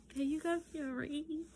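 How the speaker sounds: high excited squeal of disbelief; gasp, then squeal the question up high. Retold or imitated. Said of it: "And I was excited".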